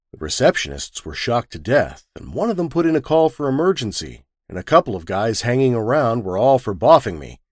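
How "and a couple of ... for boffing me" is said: usual speaking voice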